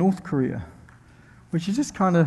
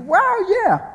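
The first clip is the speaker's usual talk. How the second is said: very high, excited squeal; high-pitched excitement; 4 dB louder than usual. Acted. Preacher acts out everyone's excited reaction, high-pitched. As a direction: let excitement send the voice way up. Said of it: "When you get excited, don't go high-pitched".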